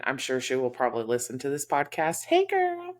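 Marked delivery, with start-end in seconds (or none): 2.2-2.9 playful high greeting. Real, not acted. Greeting friend listening to podcast.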